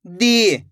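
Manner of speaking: दी with the ee held long; drawn-out vowel. Shown as a demonstration. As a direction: stretch the ee of दी. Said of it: "खींच खींच के किया जाता है".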